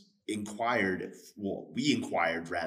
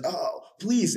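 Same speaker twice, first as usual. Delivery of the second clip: high, louder begging cry, retold; pleading debtor; 10 semitones higher, 6 dB louder than usual. Debtor begging the servant, high loud plea. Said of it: "And this man says".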